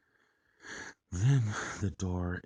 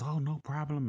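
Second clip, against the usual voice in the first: lightly voiced higher-pitched lady neighbour; higher, easy-going quoted reply; obliging lady; 10 semitones higher, 3 dB louder than usual. Lady letting a stranger through her apartment, lightly voiced as obliging.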